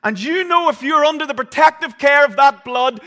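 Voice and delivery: loud, high, driving sermon voice; thundering pulpit preaching; preaching at full force. Acted. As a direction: preach it out to the congregation. Preaches assurance to congregation.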